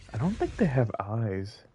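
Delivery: ordinary talk, nothing marked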